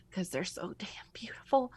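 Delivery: hushed, voiceless gush of praise; whispered aside. Real, not acted. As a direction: drop to a whisper to admire. Whispered gushing aside about the art.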